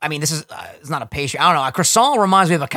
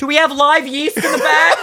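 put-on high, puzzled teen employee voice; mock clueless teen clerk; 10 semitones higher, 3 dB louder than usual. Friend mocks a bakery teen, high puzzled voice. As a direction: play the confused kid behind the counter.

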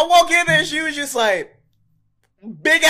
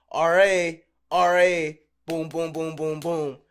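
usual speaking voice

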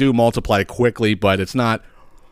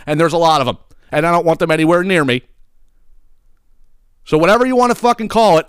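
worked-up rant; 7 semitones higher than usual. Real, not acted. Ranting about roach names.

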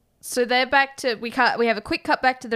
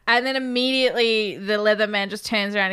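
ordinary talk, nothing marked

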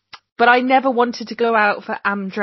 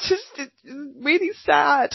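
mock tearful; 7 semitones higher, slower than usual. Child explaining crying to mum.

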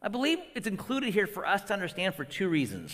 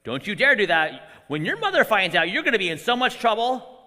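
louder stern scolding; stern parent warning; 6 dB louder than usual. Retold or imitated. Father gives his kids a stern warning, louder. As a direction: warn your kids off, firmly. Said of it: "I've given my kids stern warnings".

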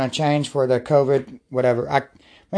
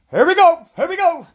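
calling from the backyard; 11 semitones higher than usual. Real, not acted. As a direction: pump yourself up, calling out. Hyping himself up outdoors.

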